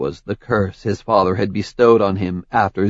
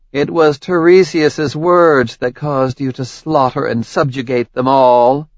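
higher, mocking goddess voice; taunting queen of the gods; Hera's voice; 4 semitones higher, 3 dB louder than usual. Hera confronts Zeus.

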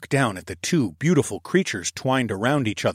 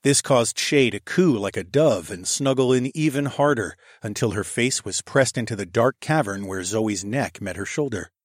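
usual speaking voice

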